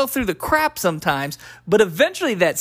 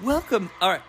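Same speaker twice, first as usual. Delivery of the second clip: ordinary talk, nothing marked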